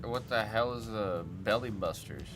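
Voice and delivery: usual speaking voice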